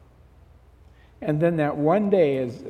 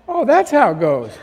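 loud, high, comic dawning understanding; mock realisation; 6 semitones higher, 10 dB louder than usual. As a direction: exclaim it as if just put straight. Retold mock realisation, audience laughs.

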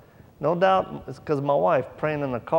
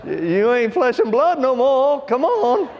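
pleading prayer that climbs higher and louder toward Come on; urgent prayer; 6 semitones higher, 4 dB louder, slower than usual. Retold or imitated. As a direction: beg the Holy Spirit, rising to a high urgent cry. Desperate prayer in the moment, retold.

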